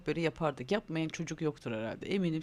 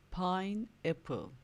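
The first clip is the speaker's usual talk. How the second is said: "Pineapple" stretched out slowly; drawn out, one syllable at a time; slow model word; 3 semitones higher, much slower than usual. Shown as a demonstration. Teacher slowly modelling "Pineapple" for kids to repeat. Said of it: "Söyle bakayım".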